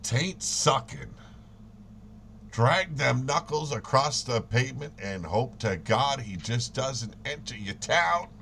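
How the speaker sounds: ordinary talk, nothing marked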